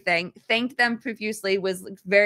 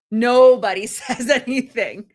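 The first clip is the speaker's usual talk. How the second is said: slow, louder, laughing through the words; 2 semitones higher, 6 dB louder, slower than usual. Real, not acted. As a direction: let the laugh break into the line. Laughing while retelling awkward silence.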